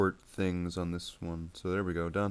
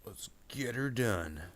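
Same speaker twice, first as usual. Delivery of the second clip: slow, higher-pitched catchphrase; drawn-out catchphrase; 4 semitones higher, slower than usual. Pretend. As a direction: stretch the words out playfully. Catchphrase, said then shouted.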